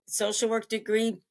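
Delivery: usual speaking voice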